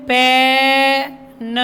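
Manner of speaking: पेन with a long-held vowel, final न separate; sounded out. Shown as a demonstration. Teacher reading PEN to pupils, पेन slowly sounded out. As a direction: hold "peh" in पेन, pause, add "nuh". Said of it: "पीईएन को क्या बोलेंगे?".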